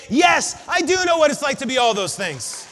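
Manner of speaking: Jesus answers back, loud and sure; triumphant shout. Acted. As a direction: declare it, ringing and emphatic.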